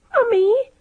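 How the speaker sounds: a tiny squeaking call to mother; high frightened squeak of a name; mouse voice. A narrator's reading. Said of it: "in that same mouse voice".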